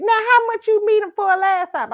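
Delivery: high, loud grandma asking. Retold or imitated. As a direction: pitch way up as grandma. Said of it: "She said".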